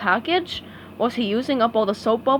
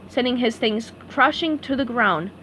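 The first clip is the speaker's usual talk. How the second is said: plain reading voice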